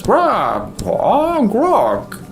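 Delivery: high croaking raven call; cartoon raven cawing. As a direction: croak it like a bird. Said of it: "and the raven just says".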